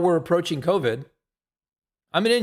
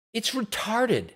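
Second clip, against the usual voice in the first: usual speaking voice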